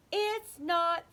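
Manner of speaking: wailing. Acted. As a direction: draw out the complaint. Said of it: "he wailed".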